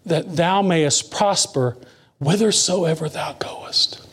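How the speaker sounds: slow, fading to a whisper; slow down and hush at the end; slow recitation sinking to a whisper; slow scripture reading. Acted.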